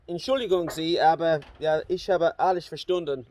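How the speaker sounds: lightly German-voiced "Entschuldigung Sie"; calm, polite German reveal; spoken German. Driver revealing to hitchhikers he understood, in polite German. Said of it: "suddenly I just went".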